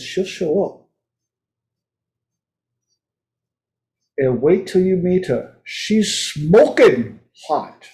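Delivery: put-on voice. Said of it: "if I talk like this".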